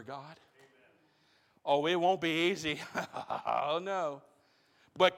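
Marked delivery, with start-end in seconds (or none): none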